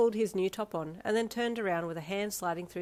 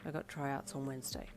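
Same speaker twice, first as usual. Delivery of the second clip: lower, softer gruff voice; 4 semitones lower, 8 dB quieter than usual. A narrator's reading. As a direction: say it gruffly, no fuss. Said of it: "he said gruffly".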